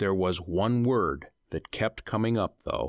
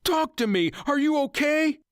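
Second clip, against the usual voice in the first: very high, loud frantic calls; frantic cry; 4 dB louder than usual. Acted. Husband crying out to his silent wife, frantic. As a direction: cry out for her, panicked.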